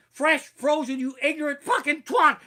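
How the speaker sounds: full-voice chef rage; screamed insult. Retold or imitated. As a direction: scream it at the cook. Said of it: "Chef Ramsay goes".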